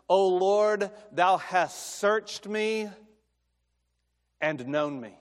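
slow reverent reading of the verse; scripture reading. Acted. Preacher reading Psalm 139 to the congregation, slow and reverent. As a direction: read the psalm slowly, with reverence.

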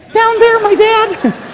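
very high, frightened squeak; comic cracked falsetto; imitated voice crack. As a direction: squeak it out slowly as your voice cracks. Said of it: "my voice cracked".

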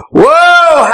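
high, loud exclamation; excited whoa. Real, not acted. Preacher exclaiming in delight. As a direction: burst out with joy.

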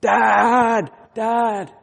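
high loud cry of Dad; child calling. Retold or imitated. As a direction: wake your father in the night. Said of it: "I was awakened by".

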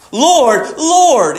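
"Lord, Lord" each punched high with a falling tone; emphatic surrender. Shown as a demonstration. Preacher showing someone's surrender to Jesus, emphatic "Lord, Lord". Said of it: "it's the most emphatic form of surrender".

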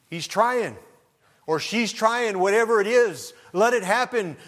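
high, loud, ringing preacher's cry; full-force preaching. Acted. Preacher rousing the congregation to change, at full force. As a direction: preach it out to the whole church.

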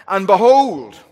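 loud, high proclamation, drawn out; a sudden emphatic burst. Acted. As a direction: boom it out like a herald. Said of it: "the Hebrew is very emphatic".

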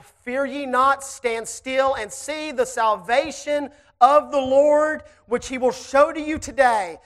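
slow, emphatic scripture read; solemn preacher's reading; preaching scripture. Acted. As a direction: lay each word down slowly.

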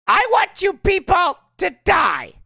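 slow, high mock shout; imitated lady's outburst. Lady yelling at street preachers, mimicked shout. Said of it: "like that one lady said to us".

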